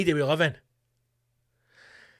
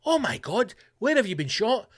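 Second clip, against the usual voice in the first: high, shocked gasp of a question; mock shock; 6 semitones higher than usual. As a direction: play the horrified friend. Acted dialogue, shocked listener.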